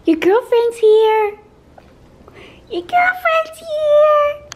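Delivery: high, slow, loud sing-song. Real, not acted. Announcing friend to baby.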